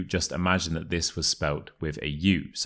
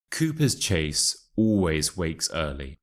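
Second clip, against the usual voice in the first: model reading. Shown as a demonstration. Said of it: "listen to the passage and repeat after me".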